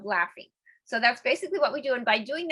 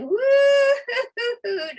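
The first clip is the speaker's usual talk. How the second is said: high, slow, loud woo-hoo; playful high woo-hoo; hula hoop whoop; 14 semitones higher, 8 dB louder, much slower than usual. Shown as a demonstration. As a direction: whoop it out high while swinging the hips. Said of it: "it's gonna be".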